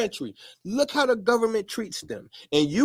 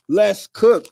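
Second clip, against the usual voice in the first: "Let's cook." punched out louder, falling hard on "cook"; loud hype call; 3 semitones lower, 7 dB louder than usual. Shown as a demonstration. Host hyping his viewers: louder "Let's cook." with a hard fall on "cook". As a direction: shout "Let's cook." like kicking off the show.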